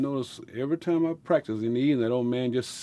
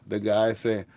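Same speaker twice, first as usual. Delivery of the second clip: ordinary delivery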